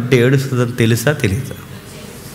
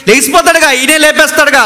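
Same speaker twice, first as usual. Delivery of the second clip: usual speaking voice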